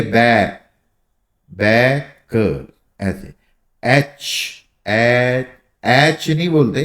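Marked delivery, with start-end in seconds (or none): 1.5-2.7 बैक drawn out very slowly, stopping on the ए; stretched demo. Shown as a demonstration. Teacher slowing बैक to show the vowel. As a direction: hold the ए in बैक. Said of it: "खाली ए पे रुक रही है".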